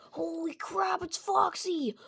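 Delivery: high-pitched startled cry, hammed up; mock alarm. Michael spotting Foxy in Pirate's Cove, mock alarm. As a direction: blurt it out in play panic.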